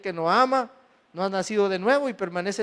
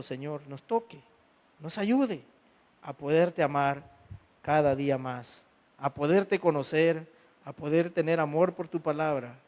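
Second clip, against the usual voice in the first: soft, hushed prayer to the Lord; 3 semitones lower, 3 dB quieter than usual. Acted. Pastor praying softly over the congregation before preaching. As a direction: pray it quietly, head bowed.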